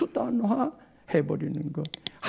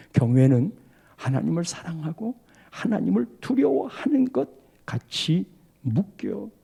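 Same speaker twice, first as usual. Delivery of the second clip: ordinary talk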